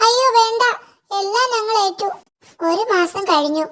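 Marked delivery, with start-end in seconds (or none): 0.1-2.1 high, hurried squeak of scared little devils; a touch of high-pitched panic; frightened imps. Acted. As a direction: cower and promise quickly. Imps answer threatening devil king.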